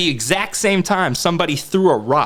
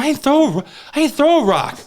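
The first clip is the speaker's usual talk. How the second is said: rising, pleading denial, higher and higher; a kid insisting he threw no rocks, getting higher and more frantic; 12 semitones higher than usual. Retold or imitated. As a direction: protest it more desperately each time. Retold protest of innocence, building.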